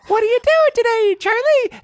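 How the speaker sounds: very high, loud squeaky greeting; friendly car bush. Retold or imitated. Talking bush greets driver's son, friendly squeaky voice. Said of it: "I'm like".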